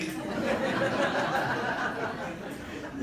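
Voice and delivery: high breathy laugh at himself; long amused chuckle. Real, not acted. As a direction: laugh at your own folly. Laughing at own ignorance.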